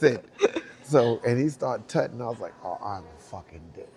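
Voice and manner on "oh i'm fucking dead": quiet doom. Retold or imitated. Quiet despair aside.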